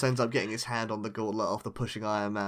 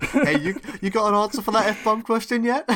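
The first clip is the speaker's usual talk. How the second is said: pushy friend asking, pitched up; nagging friend voice; 9 semitones higher, 4 dB louder than usual. Retold or imitated. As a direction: pester them for the answer.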